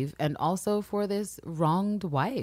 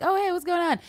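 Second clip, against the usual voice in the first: much higher, bright mock-friendly hello; chirpy greeting; 14 semitones higher than usual. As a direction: sing out the hello with a big fake smile. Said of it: "she's got a smile like".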